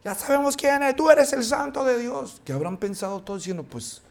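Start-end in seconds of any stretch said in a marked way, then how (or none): 0.0-2.4 high, eerie demon voice. Retold or imitated. Preacher voicing the demons speaking to Jesus, high and eerie. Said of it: "lo que los demonios le dicen a Jesús".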